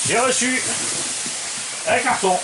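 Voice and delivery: very high loud exclamation; excited. Real, not acted. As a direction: announce it bigger, almost squealing. Repeated bigger announcement.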